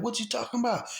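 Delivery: high-pitched retold bewilderment; bewildered reply. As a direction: act out a baffled "who, me?" reply. Acting own bewildered reply to gunman.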